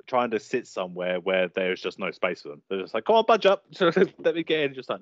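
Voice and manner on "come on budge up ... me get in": high, loud mock chirp; acted pushy request. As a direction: squeeze in with a loud, high-pitched nudge. Acts out someone squeezing into seat.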